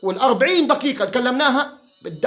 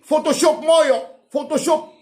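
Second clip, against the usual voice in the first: higher, louder mock whine of فوتوشوب; mocking Rashed; 6 semitones higher, 4 dB louder than usual. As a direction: parrot Rashed's claim to ridicule it. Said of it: "اللي بقول لي".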